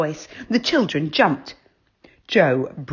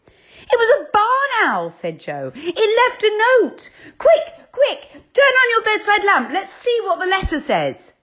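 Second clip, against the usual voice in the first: high, eager and loud; excited boy; 4 dB louder than usual. A narrator's reading. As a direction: rush the others to the lamp. Joe voiced, excited.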